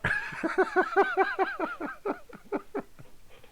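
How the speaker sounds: long high-pitched laughing fit; real cracking up. Real, not acted. Streamer teasing Phil about his typing, cracking up.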